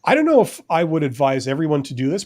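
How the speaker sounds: ordinary talk, nothing marked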